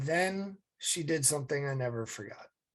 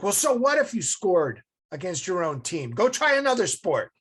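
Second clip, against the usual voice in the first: higher, louder upbeat mom voice; encouraging mom; 5 semitones higher, 6 dB louder than usual. Retold or imitated. Mom urges her son to try another sport, brightly and loud. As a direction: cheer him on to try again.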